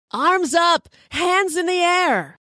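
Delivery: high-pitched shouted police commands, drawn out at the end; yelled orders. Retold or imitated. SWAT team yelling at mother and child at the door, imitated shout. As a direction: yell it like a SWAT officer bursting in. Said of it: "And they're yelling".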